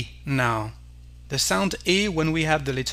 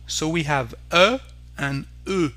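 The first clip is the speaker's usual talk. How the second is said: ordinary delivery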